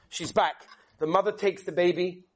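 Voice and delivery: usual speaking voice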